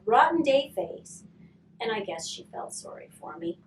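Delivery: usual speaking voice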